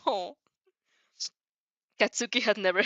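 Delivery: plain reading voice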